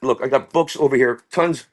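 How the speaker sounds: ordinary talk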